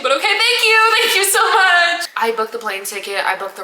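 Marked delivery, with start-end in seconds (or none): 0.0-2.1 high, loud forced thanks; mock gratitude, sarcastic; sarcastic thanks to her mom; fake polite thanks. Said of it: "you don't seem to understand".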